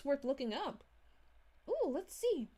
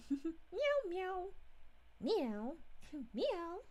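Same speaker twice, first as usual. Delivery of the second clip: playful meow; 4 semitones higher, 3 dB quieter, much slower than usual. Pretend. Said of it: "Yes, I was a tuxedo cat".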